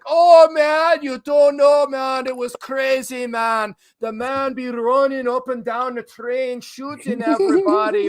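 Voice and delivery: high, hammed-up fake Jamaican accent. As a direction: imitate a prank caller's fake Jamaican accent. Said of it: "pretending like he had a Jamaican accent".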